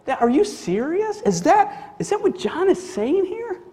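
halting, questioning, rising into astonishment; incredulous listener. Acted. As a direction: puzzle it out, then question it in disbelief. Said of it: "And you go".

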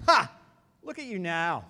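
read-aloud jeer opening on a sharp "Ha"; a touch of mocking heckle, lightly acted; mild mockery. A narrator's reading. Passers-by jeering at Jesus on the cross, mildly mocking. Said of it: "shaking their heads in mockery".

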